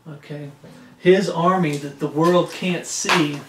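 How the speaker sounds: usual speaking voice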